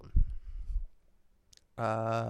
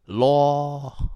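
Singlish interjection; 4 semitones higher, 7 dB louder than usual. Shown as a demonstration. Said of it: "three most common Singlish words".

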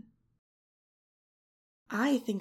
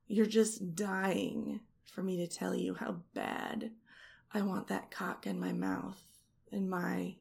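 usual speaking voice

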